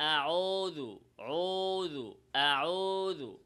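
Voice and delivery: slow drawn-out আউযু with rounded lips; Quranic recitation demo. Shown as a demonstration. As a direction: stretch আউযু slowly, lips pushed forward. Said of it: "কি বলতে হবে?". (ঠোঁট গোল করে ধীরে টেনে আউযু)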